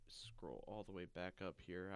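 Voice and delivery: unvoiced whisper under the breath. Real, not acted. Co-host whispers while scrolling back up the page, whispered aside. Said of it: "whispered aside while scrolling".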